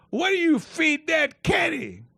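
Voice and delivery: high, slow, loud taunt; mocking heckler shout. Heckler in the gallery jeering about the big caddy, loud slow taunt. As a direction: jeer it slowly at the caddy.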